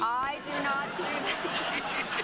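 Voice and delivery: very slow, drawn out through a laugh; laughing off the flirting charge; laughing denial. Real, not acted. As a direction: protest while laughing at herself. Denying she flirts, laughing.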